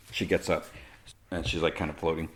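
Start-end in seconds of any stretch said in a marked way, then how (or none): none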